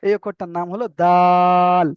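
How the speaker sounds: slow, stretched letter name দাল; দাল read slowly with the vowel drawn out; drawn-out Dal. Shown as a demonstration. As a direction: hold the vowel of দাল long, like Quran recitation. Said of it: "তিন আলি পরিমাণ টান দিয়ে বলতে হবে".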